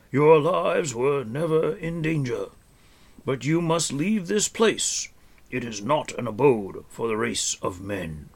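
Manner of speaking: stern warning with a kind edge; stern but kind old man. A narrator's reading. Old hermit tells travellers to leave, stern but kind. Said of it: "he said in a deep voice that was stern but not unkind".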